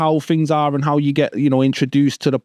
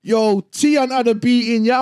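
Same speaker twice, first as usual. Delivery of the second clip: imitating the lads; 11 semitones higher than usual. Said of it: "they're going".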